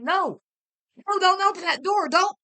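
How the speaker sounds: high loud warning shout. A narrator's reading. Husband shouting to stop his wife opening the drawer, a high loud yell. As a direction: yell to stop her in time.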